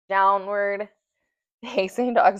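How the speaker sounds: breathless, panting between words; winded after the flow; out of breath. Real, not acted. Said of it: "Sorry, I'm getting out of breath".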